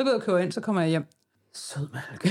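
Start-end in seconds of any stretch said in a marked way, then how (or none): 1.5-2.3 low, soft, dropped realization; flat groan of remembering; deflated groan. Retold or imitated. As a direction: let it sink as you remember too late. Remembering too late, deflated.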